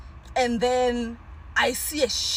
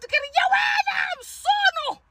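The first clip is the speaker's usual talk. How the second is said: shouting. Retold or imitated. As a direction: yell to scare off whatever's up there. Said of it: "I also shout".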